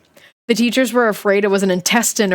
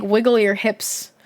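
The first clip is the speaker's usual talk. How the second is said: plain reading voice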